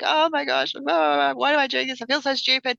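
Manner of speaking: high, exasperated inner voice mid-cry; mock crying self-talk. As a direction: act out blubbering at yourself, half laughing.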